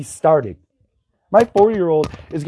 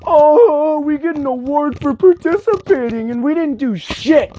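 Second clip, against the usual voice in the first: mock whining; 8 semitones higher than usual. Mocking kids given awards for participating, mock whining. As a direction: whine it out, hammed-up.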